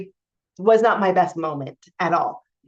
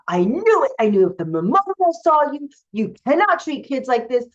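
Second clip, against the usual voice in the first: mock furious yell; 5 semitones higher than usual. Confronting the abusive coworker.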